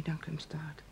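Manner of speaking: hushed, shattered whisper; a broken whisper to herself; devastated whisper. Acted. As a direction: whisper it as the truth sinks in. Still in shock, declining lift.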